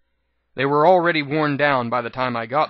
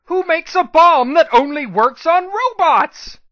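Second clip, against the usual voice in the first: much higher, much louder, slow exasperated scream; screaming back; 9 dB louder, slower than usual. Acted. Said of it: "I screamed back at her".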